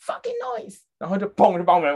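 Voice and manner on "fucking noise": high mock swear from the neighbor; a hint of an angry neighbor; mimicked angry neighbor. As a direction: squeak out the neighbor's curse. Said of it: "他就狂骂哦".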